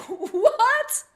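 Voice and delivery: high, loud shocked what; shocked squeak of disbelief; stunned what. Retold or imitated. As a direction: gasp it out in total disbelief. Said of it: "I'm like".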